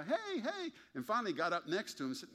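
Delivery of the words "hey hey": much higher call of hey hey; mock yell. Four guys calling to the driver, high mock yell. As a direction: call out across the parking lot. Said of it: "They started yelling at the guy".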